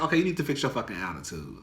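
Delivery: stern inner voice telling herself off; scolding herself. Retold or imitated. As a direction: snap yourself out of it under your breath. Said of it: "I had to check myself".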